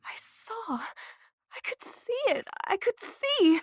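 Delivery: quiet, slow, shaken wonder; barely holding together; overwhelmed. Acted. Blind girl stunned that she could see.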